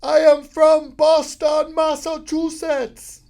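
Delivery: very high, very loud, slow yell; yelling in ear. Retold or imitated. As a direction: shout it slowly right into his ear. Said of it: "she leans into my ear and she goes".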